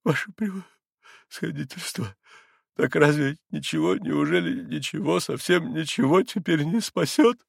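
cling to a last hope; anguished, halting question; desperate pleading. A narrator's reading. Desperate father begs for hope.

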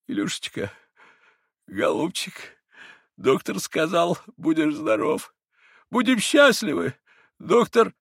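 rising, forced hopeful father; comforting father. A narrator's reading. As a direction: reassure the sick boy. Father comforts dying son. (ободряюще, с надрывом)

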